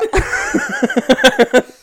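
squealing laugh; high burst of laughter. Real, not acted. Cracking up after his own impression, a high squealing laugh.

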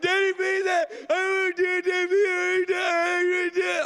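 very high, loud mock wail; hammed-up shrieking, words smeared into a wail; screaming, crying child. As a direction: imitate a hysterical kid begging. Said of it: "She's screaming, crying".

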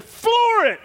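high sharp shouted order; retold shout. Husband ordering his wife to floor the gas, high shout. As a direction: punch it out like a command.

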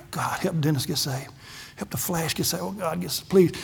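soft, pleading retold prayer under his breath; hushed prayer. Preacher retells praying for his friend in a hushed voice. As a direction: pray it quietly, almost to yourself. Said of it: "I'm praying".

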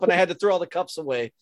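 ordinary talk

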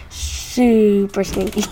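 slow, disgusted drawl; grossed out. Real, not acted. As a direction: draw it out in disgust. Grossed out by stinky feet.

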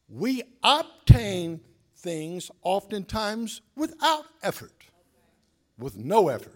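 slow, weighty sermon delivery; deliberate pulpit cadence; slow preaching. Acted. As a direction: lean on every word.

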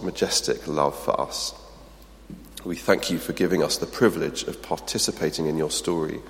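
fading hushed prayer voice. Acted. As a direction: pray quietly for the room. Closing prayer.

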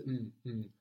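"n, n" hummed without any vowel; bare n sound. Shown as a demonstration. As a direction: isolate "n, n" as a pure nasal consonant. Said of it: "just with the n sound without any vowel before".

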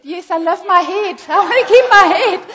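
high, loud comic wail through laughter; laughing protest. Retold or imitated. Speaker telling Iranian friend he fears beheading, laughing loud protest. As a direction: plead for your life, cracking up.

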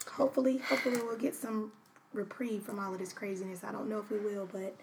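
heavy-hearted, hushed weariness; weary. Real, not acted. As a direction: let it sink quietly, drained and resigned. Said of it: "And I'm weary".

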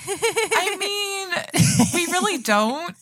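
giggling high through the words; laughing. Real, not acted. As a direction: laugh while you deny it.

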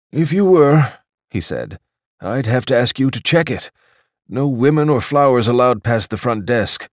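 politely firm, like a porter at the desk; a fussy doorman stating the rules; stiff club doorman. Acted.

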